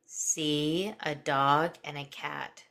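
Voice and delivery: slow, calm guided imagery; hypnotic voice. Acted. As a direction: paint each image slowly.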